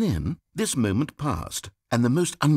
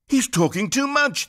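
high, louder whiny reviewer voice; mocking complainer; 12 semitones higher, 4 dB louder than usual. Narrator mimics reviewers complaining, high whiny sneer. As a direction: mimic a petty critic with scorn. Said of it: "they said".

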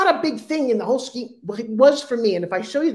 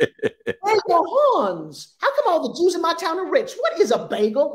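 mocking the locals; 8 semitones higher than usual. Mocking the Tulsa kids' questions.

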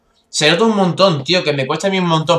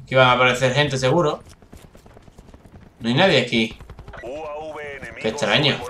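ordinary delivery

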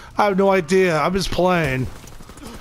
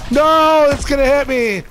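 panicked yell; 8 semitones higher, 4 dB louder than usual. Real, not acted. Panicked yell as grenade lands.

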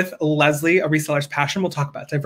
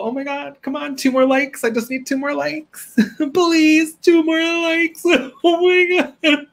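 playful pleading; 11 semitones higher than usual. Pretend. Streamer begs viewers for two more likes, playful high pleading. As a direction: plead in a high, squeaky pitch.

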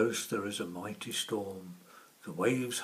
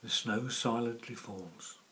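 plain reading voice

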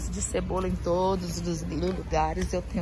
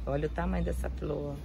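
softer and lower, murmured to herself; quieter, lower echo of the first line; soft lower repeat; 5 semitones lower, 6 dB quieter than usual. Real, not acted. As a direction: say it again under your breath in awe.

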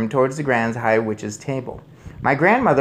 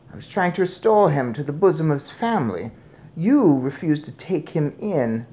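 composed grandmother; 6 semitones higher than usual. A narrator's reading. Grandmother tells father the mouse is his son, composed.

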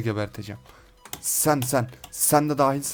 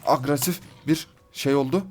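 ordinary talk, nothing marked

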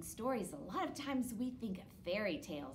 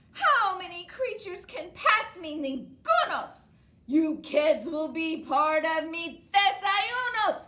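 troll voice; 7 semitones higher, 11 dB louder than usual. Acted. Troll voice continues.

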